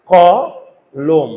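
ক, long pause, then লোম; split into syllables. Shown as a demonstration. Teacher shows how to break syllables, ক and লোম said apart. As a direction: sound out two separate syllables, ক … লোম. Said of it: "দেখো তো কিভাবে syllable ভাঙবে".

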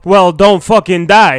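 ordinary talk

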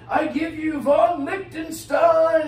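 slow, loud sung-out proclamation; herald announcing. Acted. Herald presenting his knight to the crowd, sung-out shout. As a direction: announce the champion to a cheering crowd. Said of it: "And he said".

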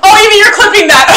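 real laughing exclamation. Real, not acted. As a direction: giggle it out in pain. Laughing at the loud wasabi reaction.

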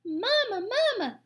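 put-on high, loud baby-bird cry; peeping gosling. A narrator's reading. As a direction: peep it like a hungry hatchling. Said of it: "peeped the gosling".

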